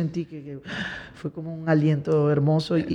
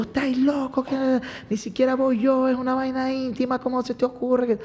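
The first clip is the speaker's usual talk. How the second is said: retold scolding in a raised pitch; mock angry scolding; 7 semitones higher than usual. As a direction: imitate an outraged manager chewing someone out. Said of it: "el manager le prendió un peo".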